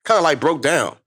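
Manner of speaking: ordinary talk, nothing marked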